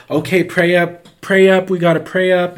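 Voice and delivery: rally the congregation in alarm; retold urgent church cry. Acted alarm of praying crowd.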